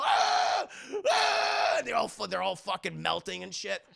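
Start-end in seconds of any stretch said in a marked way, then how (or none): 0.0-1.8 high, loud mock shriek; imitated cries of agony; victims' screams. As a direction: scream as if splashed with acid. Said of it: "he fucking throws it at him. They go".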